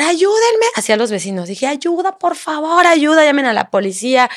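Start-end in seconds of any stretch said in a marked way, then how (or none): 0.0-0.7 high-pitched call, not full volume; retold scream for help. Woman calling to neighbours, retold high scream. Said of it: "empecé a gritar". 1.7-4.3 high-pitched plea without full volume; retold frantic scream. Woman in the street begging neighbours, retold frantic cry. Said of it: "gritando como loca".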